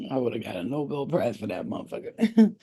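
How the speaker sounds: normal talking voice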